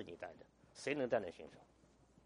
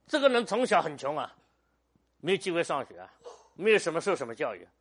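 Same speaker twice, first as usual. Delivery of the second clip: loud storytelling; 4 semitones higher, 9 dB louder than usual. Real, not acted. Telling Moody's poor childhood.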